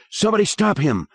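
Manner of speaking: high desperate yell; girl yelled. Acted. A girl yelling for help in class, high yell. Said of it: "a girl yelled".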